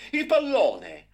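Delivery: high, loud shout; boy shouting back at his father; urgent cry. A narrator's reading. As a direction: cry out what you are looking for.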